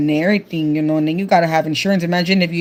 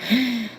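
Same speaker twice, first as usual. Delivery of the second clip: a short sharp gasp at scary news; alarmed gasp; 4 semitones higher, 6 dB quieter than usual. Real, not acted. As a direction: suck in air, shocked. Reacting to tornado news.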